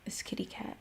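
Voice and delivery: very soft, almost whispered aside; hushed aside. Real, not acted. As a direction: murmur it fondly to yourself about the cat. Murmured aside about her cat.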